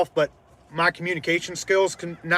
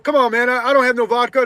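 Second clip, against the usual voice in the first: higher, louder whiny protest; guy protesting; 8 semitones higher, 7 dB louder than usual. Retold or imitated. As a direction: mimic the guy pleading innocence. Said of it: "He's like".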